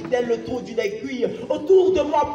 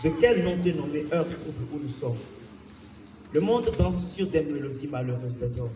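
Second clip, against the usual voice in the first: low, soft poetic lament; subdued declamation; 8 semitones lower, 6 dB quieter than usual. Acted. Poet addressing a troubled hour, subdued and low. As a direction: drop right down and lament quietly. Poem drops after the rant.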